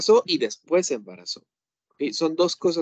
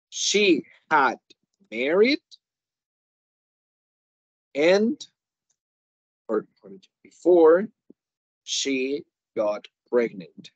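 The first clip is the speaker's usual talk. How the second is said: slow emphatic model; much slower than usual. Shown as a demonstration. Teacher as annoyed woman clarifying she married first, slowly. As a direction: spell out the order of events slowly.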